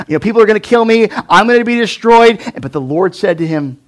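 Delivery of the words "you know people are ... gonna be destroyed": high, loud, fast worry; panicky Cain. Retold or imitated. Cain panicking to God, high, loud and fast. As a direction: rush it out in panic. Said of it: "So Cain's like".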